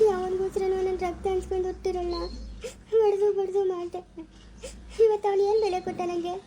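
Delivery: tearful, voice breaking with sobs; wife weeping over her husband's betrayal; crying. Acted. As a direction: cry through the words, hurt by the husband.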